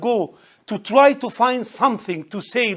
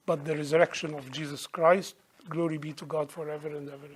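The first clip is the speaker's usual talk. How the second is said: soft, low, reverent preaching; solemn sermon close; 3 semitones lower, 6 dB quieter than usual. Real, not acted. Preacher closes on the resurrection and glory, soft and solemn. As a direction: let the voice sink into hushed reverence.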